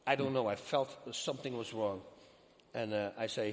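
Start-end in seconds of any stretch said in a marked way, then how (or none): none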